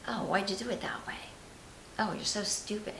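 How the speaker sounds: soft, half-whispered self-scolding; hushed inner critic. Acted. Inner critic to herself over little mistakes, hushed scolding. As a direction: murmur the put-down under your breath.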